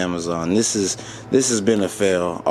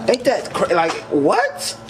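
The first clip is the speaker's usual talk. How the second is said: high-pitched incredulous outburst; half-laughing stunned what; disbelief; 8 semitones higher than usual. Real, not acted. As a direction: still can't believe it. Disbelief at failed mod.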